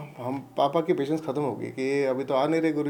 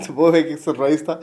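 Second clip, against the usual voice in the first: light laugh leading into the words; said through a brief laugh; amused chuckle. Real, not acted. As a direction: chuckle as you recall it. Chuckles while recalling the surprise.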